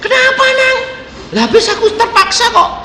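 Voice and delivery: high anxious questioning. Retold or imitated. Father asking his son why, high and anxious. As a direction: press the boy for an answer, high.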